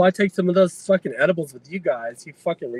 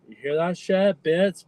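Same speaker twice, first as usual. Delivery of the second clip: normal talking voice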